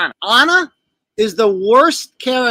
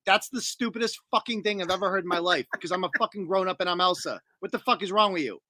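lightly voiced scornful older sister, softer and lower; deadpan Elsa; 5 semitones lower, 6 dB quieter than usual. Grown-up sister scolds the lovestruck one, low understated scorn. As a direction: put her down quietly, mildly fed up. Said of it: "And Elsa's like".

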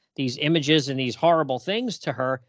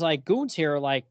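ordinary talk

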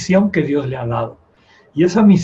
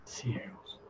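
dramatic whisper; 5 semitones lower, 21 dB quieter than usual. Acted. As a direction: whisper the word for effect. Preacher stresses spiritual blindness. (susurro dramático)

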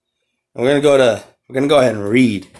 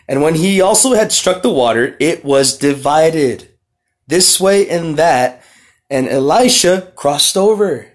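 slow, raised, solemn reading of scripture; 3 semitones higher, 3 dB louder, slower than usual. Acted. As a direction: read the verses out like a preacher, slowing down. Reads scripture aloud.

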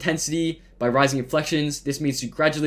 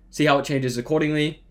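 normal talking voice

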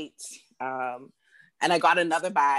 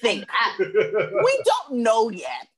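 laughing reply; 5 semitones higher, 3 dB louder than usual. Retold or imitated. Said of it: "I'm like".

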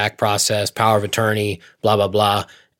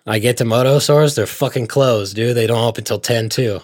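usual speaking voice

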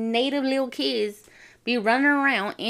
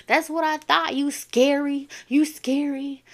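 higher-pitched mock taunting; cocky, pitched-up teasing quote; retold taunt; 5 semitones higher than usual. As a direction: goad the bully as she turns away. Acted taunt in quarrel.

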